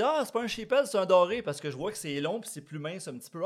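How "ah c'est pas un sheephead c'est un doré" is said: higher, louder exclamation of a fishing buddy; retold excited realization. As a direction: act out a buddy correcting the catch. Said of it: "Puis là, Mané dit".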